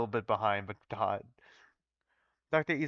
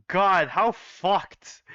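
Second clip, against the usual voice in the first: drawn-out dismay; 5 semitones higher, 5 dB louder, much slower than usual. Real, not acted. Dismayed rant about bad options.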